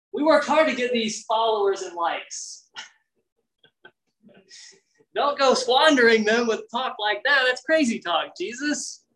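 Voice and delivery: playfully whining at Jesus about losing followers; disciples complaining. Retold or imitated. Disciples scolding Jesus, mock exasperated. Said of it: "his disciples were like".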